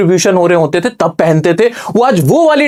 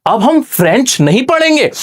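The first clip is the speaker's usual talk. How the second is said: shocked disbelief; 6 semitones higher than usual. Retold or imitated. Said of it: "France एकदम हैरान हो गया".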